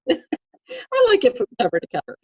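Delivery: high, laughing through the words; giggling, playing along with the joke. Real, not acted. Mom laughing along with her daughter's joke, giggling. Joking she likes whole Bible.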